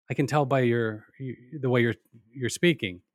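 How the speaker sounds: ordinary delivery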